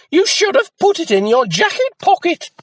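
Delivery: very high, loud shrieked insult; angry cry. A narrator's reading. Mother scolding Jack over the lost pound, shrieking. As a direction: spit out the insult at full pitch. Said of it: "she cried".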